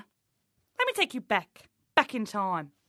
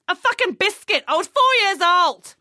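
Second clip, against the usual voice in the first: much higher and louder, furious; outraged shout; 8 semitones higher, 9 dB louder than usual. Acted. Coach ranting about her father's laser alarm, loud and outraged. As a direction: explode over the biscuit.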